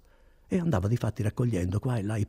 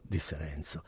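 usual speaking voice

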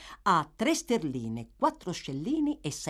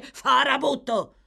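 angry insult; 8 semitones higher, 6 dB louder, slower than usual. Acted.

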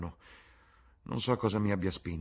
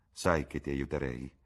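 plain reading voice

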